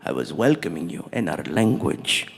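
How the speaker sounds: very low, measured, dignified prince; prince's voice. Retold or imitated. Prince explaining the name to the comic, low and dignified. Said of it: "And he's like".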